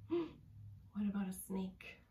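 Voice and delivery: gasp, then a hushed whisper. Pretend. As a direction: whisper it like a secret. Spooky hushed question to kids.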